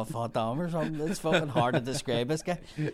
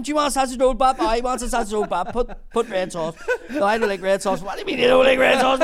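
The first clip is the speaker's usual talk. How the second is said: much louder, higher laughing back-and-forth; loud banter; 10 semitones higher, 9 dB louder than usual. Retold or imitated. Apprentice refusing red sauce from Bumper: loud laughing argument. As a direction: shout the bap argument through laughter. Quarrel over sausage bap.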